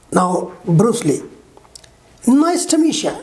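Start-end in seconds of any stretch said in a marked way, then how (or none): 2.3-3.1 higher "meet you" as "meecha"; reduced form. Shown as a demonstration. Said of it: "What he says".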